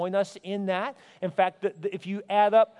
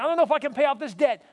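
higher, quick acted fretting; worried objection; 6 semitones higher, 3 dB louder, faster than usual. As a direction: voice a debtor's doubt, fast and high. Voices a debtor's worried objection.

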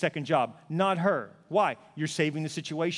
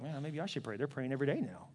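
quiet musing spouse; 5 semitones lower, 10 dB quieter than usual. Retold or imitated. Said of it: "They're like".

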